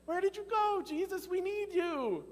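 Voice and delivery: high, urgent call of the disciples; pleading disciples. Acted. Disciples voiced searching for Jesus.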